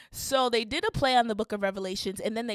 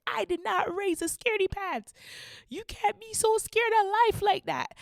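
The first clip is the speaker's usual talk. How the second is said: angry dad; 10 semitones higher than usual. Retold or imitated. Angry dad at Six Flags.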